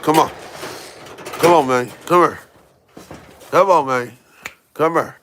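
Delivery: high, loud call to a puppy; calling the dog. Real, not acted. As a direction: coax the pup over.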